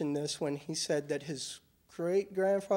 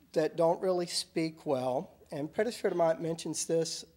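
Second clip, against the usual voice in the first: normal talking voice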